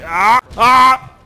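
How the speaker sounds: two short, high, loud screams; startled scream. Real, not acted. Player caught off guard in game, startled scream. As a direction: cry out in sudden alarm.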